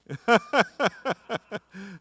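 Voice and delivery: real high, extended laugh. Real, not acted. Preacher reacting to a listener's answer, laughing.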